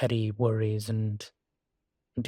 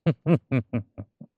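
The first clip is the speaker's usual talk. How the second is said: chuckle; 2 semitones higher, 4 dB louder than usual. Acted. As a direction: run of short chuckles. Amused at being stood up to.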